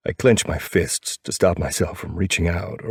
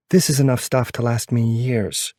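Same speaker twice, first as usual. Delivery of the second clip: female character voice; 6 semitones higher than usual. A narrator's reading. Narrator voicing girlfriend's reply.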